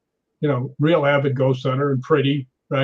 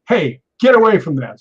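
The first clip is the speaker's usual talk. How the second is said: sharp, louder call to back off; retold warning shout; 3 semitones higher, 6 dB louder than usual. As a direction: call out a warning, mildly acted. Warning off intruders.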